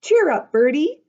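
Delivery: high, loud bright cheer-up; Polly cheers up her brother; cheerful sister. Acted. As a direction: lift him up with a sunny cheer. Sister comforting Bertie.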